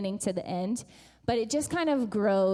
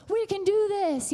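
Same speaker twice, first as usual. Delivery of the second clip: higher coach's rallying cry; pumping them up; 10 semitones higher, 4 dB louder than usual. Retold or imitated. Coach in the huddle to his team, pumping up. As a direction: fire up the huddle. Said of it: "he's pumping them up".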